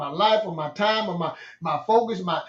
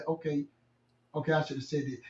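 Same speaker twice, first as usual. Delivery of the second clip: much softer, gentle apology; customer-service rep's softened redo; 5 semitones lower, 11 dB quieter than usual. Shown as a demonstration. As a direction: take the edge off and say it softly. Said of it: "A little softer".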